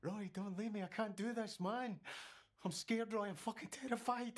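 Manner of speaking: terrified grief; tearful fear. Acted. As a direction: voice breaking with fear.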